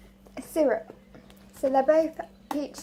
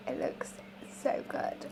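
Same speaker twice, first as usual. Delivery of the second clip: soft unvoiced whisper; 10 dB quieter than usual. Real, not acted. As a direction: breathe it in a hushed whisper. Admiring the sweets up close.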